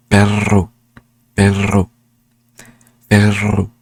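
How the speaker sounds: perro with a loud rolled rr; Spanish perro, repeated. Shown as a demonstration. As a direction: trill the rr in perro each time. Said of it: "I can say it with regular words".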